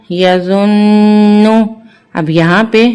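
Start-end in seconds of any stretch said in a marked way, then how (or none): 0.1-1.5 یظن very slow, the doubled ن hummed long through the nose; nasal ghunna. Shown as a demonstration. Teacher demonstrates the ghunna slowly for the children. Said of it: "ہم نے یہاں پر غنہ کرنا ہے".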